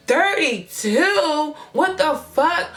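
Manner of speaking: high, drawn-out disbelieving repeat; shocked echo. Retold or imitated. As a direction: echo it back aghast, pitch way up. Shocked echo of his age.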